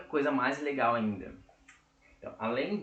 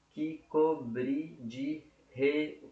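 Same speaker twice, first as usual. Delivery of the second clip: very slow syllables, broken off midway; syllable-by-syllable counting; 2 semitones higher, much slower than usual. Shown as a demonstration. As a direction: start counting out each syllable slowly. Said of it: "tem sete sílabas poéticas".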